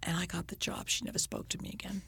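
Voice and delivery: hushed, breathy whisper; whispered punchline. Real, not acted. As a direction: drop to a whisper for the reveal. Punchline told quietly.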